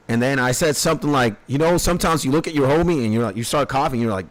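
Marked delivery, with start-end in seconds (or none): none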